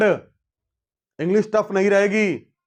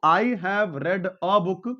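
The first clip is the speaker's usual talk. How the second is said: very slow, each word set apart; slow example with a on a rising tune; slow model sentence; much slower than usual. Shown as a demonstration. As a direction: read it out slowly so students follow. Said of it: "इसका मतलब मैंने कोई एक किताब पढ़ी है".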